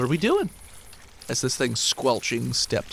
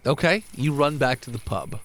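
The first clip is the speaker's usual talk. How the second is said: normal talking voice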